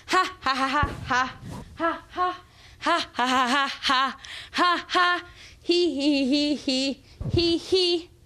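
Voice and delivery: high, toy-like ha ha laugh; squeaky doll laugh. Acted. High laugh after doll babble.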